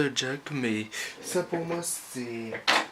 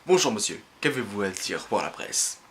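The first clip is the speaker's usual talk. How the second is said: lightly voiced reporter, dropping low; mock journalist; 7 semitones lower than usual. Journalists turning up to question someone for the press, mock reporter voice. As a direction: imitate a press interviewer in a lower voice. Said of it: "les journalistes, ils se ramènent".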